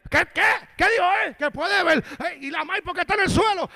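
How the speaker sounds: high, loud reaction; imitated startled crowd; astonished onlookers. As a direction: blurt it out in acted disbelief.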